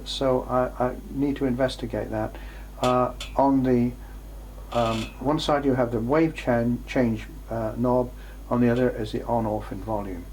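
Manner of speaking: ordinary talk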